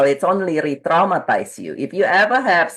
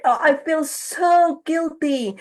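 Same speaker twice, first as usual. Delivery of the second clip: guilty lament; 9 semitones higher than usual. Retold or imitated. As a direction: let the guilt spill out slowly. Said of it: "she's like".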